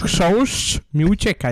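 chrząszcz slow and lisped, the sz slipping; missing front teeth. Real, not acted. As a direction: say chrząszcz slowly, hissing through a gap. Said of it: "przez te przednie zęby".

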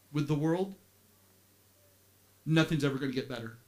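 ordinary delivery

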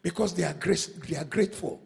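low, soft, confiding sermon voice; soft intimate preaching. Acted. Preacher softly telling congregation about grateful people. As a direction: draw them in quietly.

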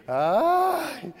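ordinary delivery

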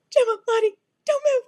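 very high, loud, tearful squeal; acted panic. Owner spotting her escaped cats, acted panic. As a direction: call out in hammed-up desperation.

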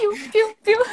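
high mocking piu; cheeky chirp. Schoolboys chirp behind the strict teacher's back, high cheeky piu. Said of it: "A gente fala assim".

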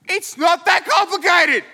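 high, loud hammed-up outburst; shouted comic exasperation; exasperated yell. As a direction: snap back in mock outrage. Acted Joseph yells back.

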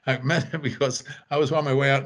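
ordinary delivery

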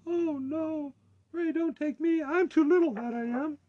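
very high, pleading little goat; small voice. A narrator's reading. Littlest goat begging the troll not to eat him, tiny high plea. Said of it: "with such a small voice".

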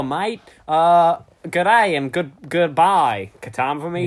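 hammed-up bad Australian accent; Mister Aussie. Mister Aussie signing off from the show, overdone Australian accent. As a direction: lay the Aussie slang on thick. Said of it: "bad impression of an Australian person called Mister Aussie".

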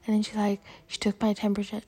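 normal talking voice

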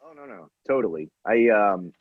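ordinary talk, nothing marked

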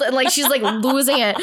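usual speaking voice

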